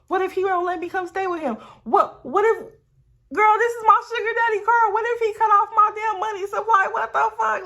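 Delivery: high-pitched, escalating panic; panicking. Retold or imitated. Storyteller replays worrying about sugar daddy's car, rising panic. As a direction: spiral upward into loud, frantic worry. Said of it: "I'm just panicking".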